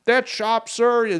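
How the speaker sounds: high, loud, drawn-out mock command; imitated police yelling. Cops ordering the shop owner back inside, imitated yelling. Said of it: "and they're yelling".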